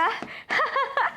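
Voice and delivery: mocking high laugh.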